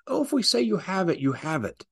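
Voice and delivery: high, smug mock official voice; high sneering mock tyrant; mocking the rulers. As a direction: lord it over them in falsetto. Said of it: "the Europeans are classic communitarians".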